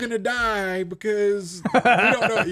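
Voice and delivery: high, slow mock doom; high drawn-out parody panic. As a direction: wail it like a melodramatic commenter. Third parody commenter.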